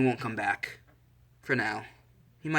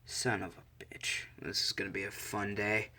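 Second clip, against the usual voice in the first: sighing resignation; 2 semitones lower, 7 dB quieter than usual. Acted. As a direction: mutter it softly, resigned to trouble. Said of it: "Izuku sighs and goes".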